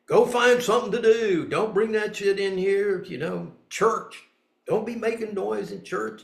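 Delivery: put-on sharp, loud parent scolding a child. Mother scolding a noisy child in church, sharp and loud. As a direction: bark it like a strict mother in church.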